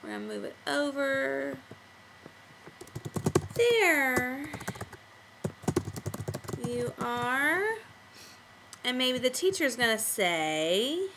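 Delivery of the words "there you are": words far apart while typing; drawn-out dictation to oneself; typing aloud. Shown as a demonstration. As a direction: stretch each word and wait between them. Typing talking balloon aloud.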